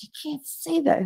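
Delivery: high-pitched hushed scolding; retold urgent reproof. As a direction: act out correcting a colleague. Urgently correcting the teacher, retold.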